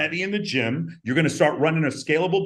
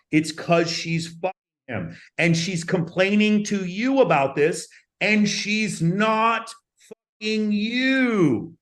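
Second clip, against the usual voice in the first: higher and slower, each word weighted; emphatic slow build; 6 semitones higher, slower than usual. Real, not acted. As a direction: lean in hard, slow it right down. Driving home key point.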